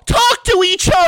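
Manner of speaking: high loud sideline yell; screaming soccer mom. Retold or imitated. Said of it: "the moms that scream".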